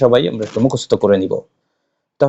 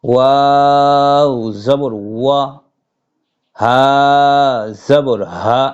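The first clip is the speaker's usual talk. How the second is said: slow Arabic letter drill; 2 semitones higher, much slower than usual. Shown as a demonstration. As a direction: recite each letter slowly like a Quran teacher. Leads a recitation drill. (ধীরে টেনে টেনে আরবি হরফ পাঠ)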